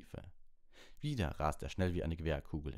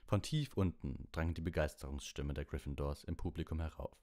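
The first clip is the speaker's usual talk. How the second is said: ordinary delivery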